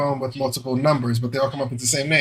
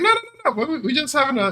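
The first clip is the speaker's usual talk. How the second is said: exasperated denial; 11 semitones higher than usual. Retold or imitated. Correcting confused daughter on call.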